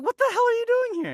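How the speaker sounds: high, fast disbelieving exclamation; shocked. Retold or imitated. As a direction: blurt it out in shock.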